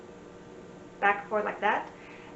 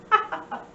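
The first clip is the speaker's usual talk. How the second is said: high, airy ha-ha-ha; demonstrated laugh; 9 semitones higher, 6 dB louder, faster than usual. Shown as a demonstration. Sign teacher offering another way to laugh, mock laughter. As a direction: fake a quick string of laughs. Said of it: "'cause you're laughing".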